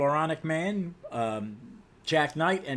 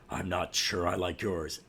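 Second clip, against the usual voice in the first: low, restrained hero retort; Batman; 7 semitones lower, 3 dB quieter than usual. Acted. Stern superhero answering a complaint about his tone, low and cool. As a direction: answer back low and controlled. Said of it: "And Batman's".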